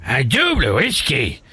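high, laughing crude comic; Jean-Marie. Acted. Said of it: "un ami des grosses têtes, Jean-Marie".

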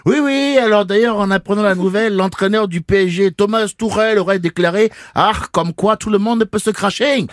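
put-on léger accent allemand de Tuchel, sur un ton plus aigu. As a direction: imite un entraîneur allemand sans trop forcer. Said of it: "bosser mon accent allemand". (un soupçon d'accent allemand)

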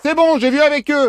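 high pretend aside to another person; staged call-out. Calls out to the pretend patient.

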